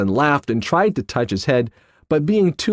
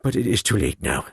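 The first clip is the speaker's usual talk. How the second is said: mournful regret; 7 semitones lower, 4 dB quieter than usual. A narrator's reading. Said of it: "drying his eyes".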